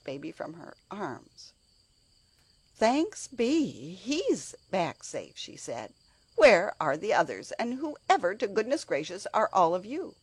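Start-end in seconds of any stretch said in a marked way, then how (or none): 2.7-5.4 relieved. Acted. Nursemaid relieved baby returned. 6.3-10.2 indignant. Acted. Nursemaid confronts strange children.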